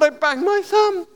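put-on a small boy reporting a banged thumb; young boy on the phone; hurt child. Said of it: "when I was nine years old".

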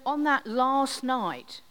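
usual speaking voice

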